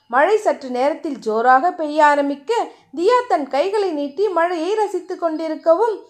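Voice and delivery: ordinary delivery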